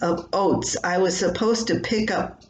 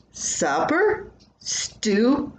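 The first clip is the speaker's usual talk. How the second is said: much higher, puzzled rising questions; puzzled husband; 6 semitones higher, much slower than usual. Retold or imitated. Distracted husband, puzzled by his wife's supper question. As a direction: sound caught off guard and confused.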